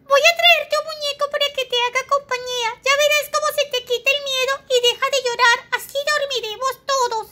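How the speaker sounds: high, quick, chattering rhino voice; fast squeaky rhino. Acted. Rhino promises the baby a teddy, fast and squeaky. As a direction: rattle off the promise cheerfully.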